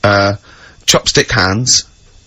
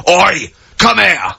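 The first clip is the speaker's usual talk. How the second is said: slow, higher call of oi come here; mock amplified shout; 5 semitones higher, much slower than usual. Frog boy fan shows off a hundred-decibel voice, mock shout. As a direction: bellow it like a walking loudspeaker. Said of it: "You can go".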